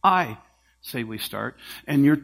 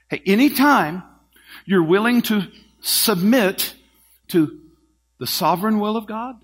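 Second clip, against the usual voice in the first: louder, higher, urging; raised appeal; 6 semitones higher, 7 dB louder than usual. Acted. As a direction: call out the invitation with force. Maestro's appeal to the soloist.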